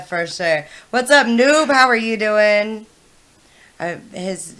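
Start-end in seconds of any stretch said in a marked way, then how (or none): 0.9-2.9 excited greeting. Real, not acted. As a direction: greet a friend with big energy. Greeting a viewer on stream.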